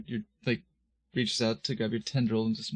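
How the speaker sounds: usual speaking voice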